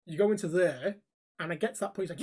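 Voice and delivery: ordinary talk, nothing marked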